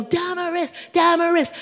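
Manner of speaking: high, sustained mock cheer of the name; high-pitched crowd call, held on one note; imagined crowd yelling. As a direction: imitate fans chanting a star's name. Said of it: "when I wanted people to yell".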